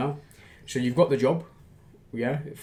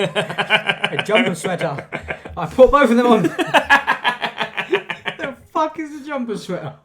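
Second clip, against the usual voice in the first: high, laughing mockery of the words; laughing in disbelief; 11 semitones higher, 6 dB louder than usual. Real, not acted. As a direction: crack up while incredulously repeating it. Co-host mocks the odd garment.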